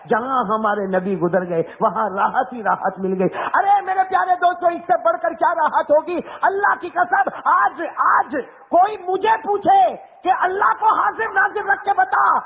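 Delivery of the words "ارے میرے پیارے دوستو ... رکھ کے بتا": fiery preaching. Acted. Preacher rousing the congregation about the holiest place, shouting. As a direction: rouse the crowd with full force.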